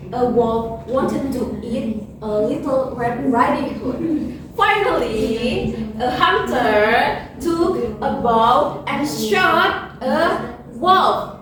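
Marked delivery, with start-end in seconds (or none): none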